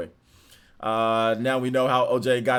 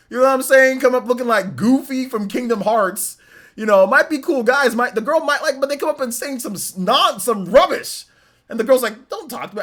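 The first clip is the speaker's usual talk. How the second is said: excited rant; 10 semitones higher, 8 dB louder than usual. Real, not acted. Excited rant about guys.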